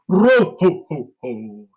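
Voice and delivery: slow, loud, jolly Santa laugh; belly-laugh it like Santa. Shown as a demonstration. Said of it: "put it on our big Santa belly".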